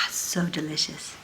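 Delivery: soft, slow contented sigh of delight; satisfied sip. Shown as a demonstration. Teacher pretending to sip juice, soft and satisfied. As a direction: savor it slowly and softly. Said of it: "Pretend you have a glass".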